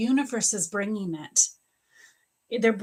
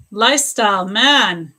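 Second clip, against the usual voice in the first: emotional outburst; 9 dB louder than usual. Real, not acted. Emotional reaction to a viewer.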